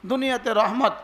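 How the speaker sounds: ordinary talk, nothing marked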